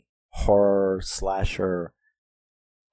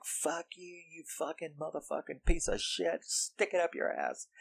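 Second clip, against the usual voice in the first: high, hushed imitation of screaming; mock scream; 9 semitones higher, 11 dB quieter than usual. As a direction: scream under your breath in a high put-on voice. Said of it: "like, screaming at him".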